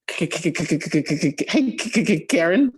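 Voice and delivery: nervous, stuttering mock fear; terrified stutter. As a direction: stammer her name, too scared to call. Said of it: "She was terrified to call this woman".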